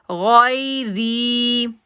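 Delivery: "गैरी" slow, thick "गै" and stretched "री"; heavy ghain, long ree. Shown as a demonstration. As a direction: make "गै" heavy and hold "री". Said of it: "मोटा करेंगे और री को खींचेंगे". ("गै" मोटा, "री" लंबा)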